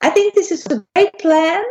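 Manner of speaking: high voice with the tummy tucked in. Shown as a demonstration. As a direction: say it high and squeaky, stomach pulled tight. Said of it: "tuck in my tummy and say".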